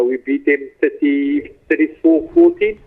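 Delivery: normal talking voice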